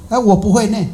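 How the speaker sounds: high, whiny protest from a poor beggar; a lightly voiced beggar pleading he can't do it; imitating the beggar. Beggar refusing the monk, in a mock whiny voice. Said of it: "他说我不会念啊".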